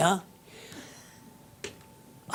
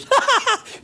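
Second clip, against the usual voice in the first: mocking high cackle; 7 dB louder than usual. Mocking onlookers laughing.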